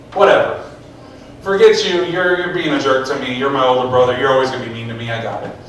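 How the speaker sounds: resigned, trailing into a low grumble; dismissive brush-off. Retold or imitated. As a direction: shrug off a bullying older brother. David brushes off mean brother.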